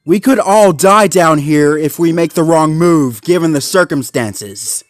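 loud, high, insistent argument; urgent warning. Acted. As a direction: warn of the danger forcefully. Zuma warns Marshall urgently.

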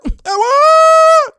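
very high, drawn-out holler; loud celebratory and-one call; excited shout. Real, not acted. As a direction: yell the call at the top of your voice. Calling out a basket.

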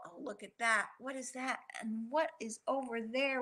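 usual speaking voice

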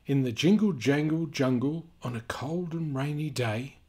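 lively storybook reading voice; sing-song read-aloud for kids; reading to children. A narrator's reading. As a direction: read it out like a picture book for little ones. Reading picture book aloud.

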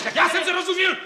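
high, irate outburst; quarrelling. Acted. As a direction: blow up at the family. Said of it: "že se hádáme". (podrážděný výbuch hněvu)

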